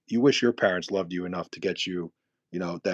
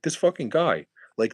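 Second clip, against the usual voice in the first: ordinary talk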